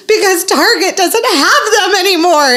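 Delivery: high, overblown mock whine; wailing child. Mom imitating child despairing over the store, wailing. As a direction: sob it out like a tragic kid. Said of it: "And she's like".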